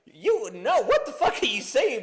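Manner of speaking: high, loud disbelieving yell; Kevin Hart outrage. Retold or imitated. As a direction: shriek it in comic disbelief. Imitating Kevin Hart's outrage.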